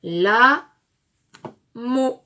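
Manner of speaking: "la", pause, "mot", loud separate beats; syllable by syllable. Shown as a demonstration. Teacher assembles syllables for pupils, "la" and "mot" split. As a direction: split "la" and "mot" into two blocks. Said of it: "Et nous avons".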